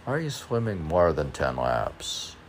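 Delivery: ordinary talk, nothing marked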